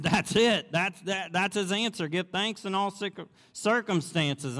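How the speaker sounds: high, loud joyful exclaiming; excited discovery. Retold or imitated. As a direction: burst out with the answer. Betsy exclaims the answer excitedly.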